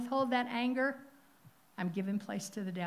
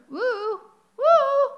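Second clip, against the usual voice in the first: high, loud preacher's whoop; excited whoop; 15 dB louder than usual. Real, not acted. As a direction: let out a joyful holler. Preacher whooping mid-verse.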